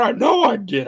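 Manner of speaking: insistent. Retold or imitated. Retelling the dream fight, insisting loudly. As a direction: swear you landed it.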